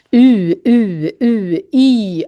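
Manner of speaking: "U, U, U" as long separate falling vowels, then "I"; vowel drill. Shown as a demonstration. Teacher models the difficult Swedish U, drawn-out vowel drill. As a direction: hold each "U" out, then switch to a long "I". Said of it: "U kan vara svårt att säga".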